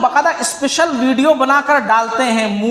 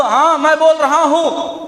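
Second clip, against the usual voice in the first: higher-pitched, self-important put-on voice; puffed-up, arrogant; 5 semitones higher than usual. Shown as a demonstration. Preacher showing children how not to talk, arrogant raised voice. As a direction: show off with a swaggering raised voice. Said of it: "اس انداز سے بات نہیں کرنا، اکڑ کر کے".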